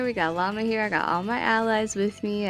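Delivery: ordinary talk, nothing marked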